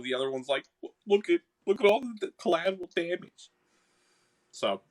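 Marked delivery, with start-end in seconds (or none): 1.1-3.5 high Boston sprite arguing back; high-pitched Boston-accented character. Acted. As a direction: argue in a raised Boston voice. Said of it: "I'm stuck in the Boston accent".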